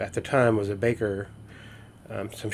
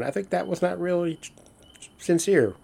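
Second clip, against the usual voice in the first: higher, sceptical inner voice; a mock doubting aside; walking away from hollow praise, sceptical; doubtful; 8 semitones higher than usual. Imagined muttered doubt.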